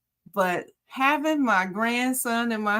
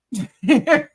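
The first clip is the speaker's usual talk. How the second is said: loud, high laugh; 7 semitones higher, 8 dB louder than usual. Real, not acted. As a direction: burst out laughing at your own remark. Laughs after her own joke.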